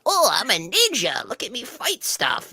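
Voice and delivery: high, slow, hammed-up kid ninja; mock ninja voice. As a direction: play a silly ninja boasting. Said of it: "like, you know, like the".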